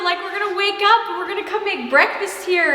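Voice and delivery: high, giddy rush of anticipation; bubbling excitement. Real, not acted. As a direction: gush about it in a high, excited voice. Excited about life in new house.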